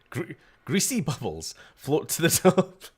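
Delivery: cracking up mid-sentence; laughing. Real, not acted. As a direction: giggle through the words. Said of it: "calling anything greasy makes me laugh".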